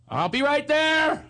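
put-on high, slow sing-song old lady voice; imitating the woman calling from inside; mock lady of the house. Rich woman answers door.

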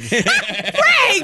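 very high, scandalized laughing cry; laughing scolding. Real, not acted. Co-host scolds Frank for his crude joke, laughing. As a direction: scold him while cracking up.

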